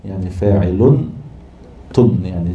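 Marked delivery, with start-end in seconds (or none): none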